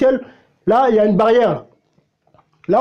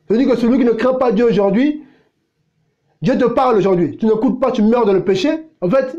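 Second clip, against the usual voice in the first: usual speaking voice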